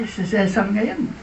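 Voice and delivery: normal talking voice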